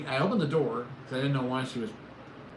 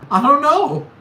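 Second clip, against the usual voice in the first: helpless shout; 12 semitones higher, 15 dB louder, slower than usual. Retold or imitated. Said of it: "I was like".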